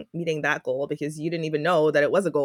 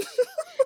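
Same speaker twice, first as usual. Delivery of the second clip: a quick giggle, pitched way up; high giggle. Real, not acted. Laughing about the noises she makes getting up.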